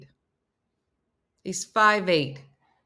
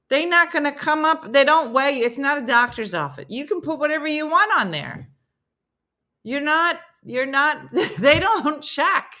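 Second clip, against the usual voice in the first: high, raised voice of mock disbelief; exasperated arguing; 8 semitones higher, 5 dB louder than usual. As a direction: argue it insistently, pitched up and loud. Retold argument over licence heights.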